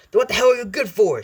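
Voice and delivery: angry, high loud outburst; Bakugo. Acted. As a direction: yell it at useless doctors, explosive. Acted Bakugo blows up at doctors.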